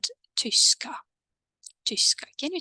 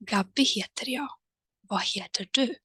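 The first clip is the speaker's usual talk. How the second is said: whispered model line; 2 semitones higher than usual. Shown as a demonstration. Teacher whispering the model dialogue to learners.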